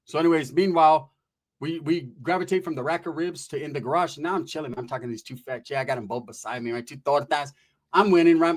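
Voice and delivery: ordinary delivery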